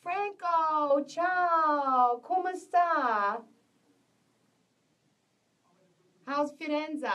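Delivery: lively high ciao to a viewer; excited greeting. Real, not acted. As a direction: call out to a friend across the room.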